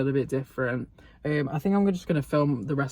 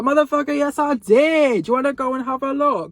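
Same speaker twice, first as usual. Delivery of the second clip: loud, high, exasperated retort; indignant shouted comeback; acted-out angry outburst; 11 semitones higher, 9 dB louder than usual. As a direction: blow up at the manager, stretching the words. Acted angry answer to manager.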